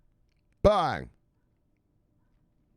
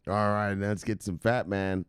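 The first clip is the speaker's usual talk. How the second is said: normal talking voice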